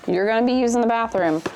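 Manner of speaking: ordinary talk, nothing marked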